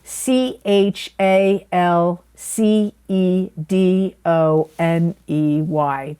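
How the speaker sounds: slow, separated letter names; spelling out. Shown as a demonstration. Crystal reader spelling a stone's name for viewers who asked, slow spelling out. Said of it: "it is spelled, people have asked me to spell it".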